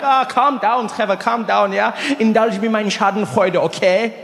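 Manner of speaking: put-on placating German-accented friend. Friend asking to be indulged in his glee, German accent. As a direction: soothe him teasingly in a German accent. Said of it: "He's like".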